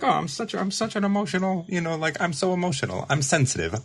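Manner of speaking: high, whiny mock of her old dramatic self; mocking overemotional self. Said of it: "It's like".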